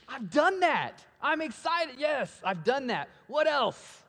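put-on a hyped kid who thinks he's already won; excited young man. Young man boasting to Jesus, pumped-up and giddy. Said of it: "and this guy's excited".

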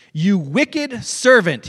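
slow, loud rebuke; acted anger. Master to the wicked servant, slow anger. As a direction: stretch it out as a stern accusation. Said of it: "In anger, his master".

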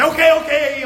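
mock eager concession; eager giving in. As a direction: blurt out a hurried surrender. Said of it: "to get Joseph to say".

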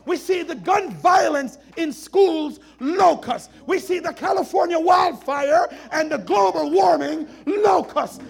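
loud, high fiery preaching; doomsday street preacher. Acted. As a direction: shout it like a revival preacher. Preaching at full force.

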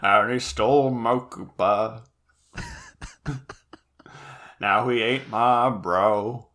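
slow, mournful country twang; country song voice. Pretend. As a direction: lament it like a country ballad. Mock country song lyric.

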